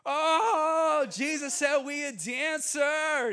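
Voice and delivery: loud, high and slow, nearly crying; tearful excited shout. Retold or imitated. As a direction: cry it out in overwhelmed joy. Girls cry out after prophecy.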